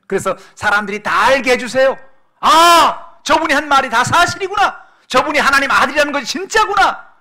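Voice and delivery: loud, high, impassioned plea; full-force preaching. Acted. Preacher voicing Jesus pleading with the Father, full-force preaching. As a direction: cry out the plea and the crowd's amazement.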